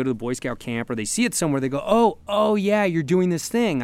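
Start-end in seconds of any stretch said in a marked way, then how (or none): 1.8-3.8 higher, drawn-out "oh" of realization; dawning recognition. Retold or imitated. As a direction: act out an onlooker suddenly catching on. Onlookers' dawning recognition, acted out.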